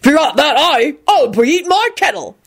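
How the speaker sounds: high-pitched rustic retort; scornful. A narrator's reading. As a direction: scoff like a drunken heckler. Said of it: "Hagrid snorted".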